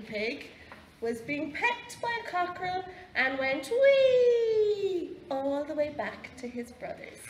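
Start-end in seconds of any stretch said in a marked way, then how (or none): 3.7-5.2 long, high, loud "wee"; drawn-out piggy squeal. A narrator's reading. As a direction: let out a sustained high squeal. Pig runs off squealing in story.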